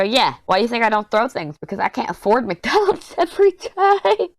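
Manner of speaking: high, laughing comeback, retold to her son; laughing retort. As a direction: laugh through the punchline. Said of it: "And I go".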